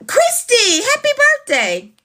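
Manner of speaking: thrilled to see a friend's birthday; delighted surprise. Real, not acted. Spots a viewer's birthday in chat.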